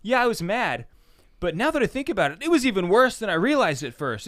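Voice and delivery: high, loud, mounting indignation; worked-up grievance. Acted. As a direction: stew aloud until the offense grows bigger. Acts out stewing, anger escalating.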